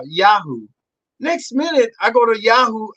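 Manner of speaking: ordinary talk, nothing marked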